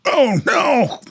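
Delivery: put-on a kid groaning at cat fur everywhere; whiny young Tom. Tom complains about Martha shedding, high and dismayed.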